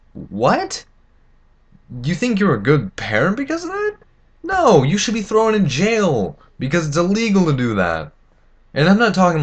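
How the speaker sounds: high, incredulous outburst at bad parents; outraged disbelief. Real, not acted. Ranting at parents who let kids drink, outraged and high. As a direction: pitch flies up in angry disbelief.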